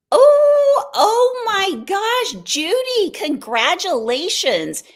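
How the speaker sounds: high, drawn-out gushing congratulations; delighted amazement. Real, not acted. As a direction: squeal it, stretching the words in real delight. Reacts to viewer's anniversary news.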